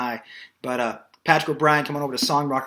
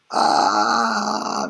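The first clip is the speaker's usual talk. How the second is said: vocal fry; 4 semitones lower, 3 dB louder than usual. Shown as a demonstration. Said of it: "just a little bit of vocal fry".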